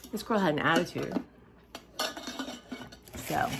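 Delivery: normal talking voice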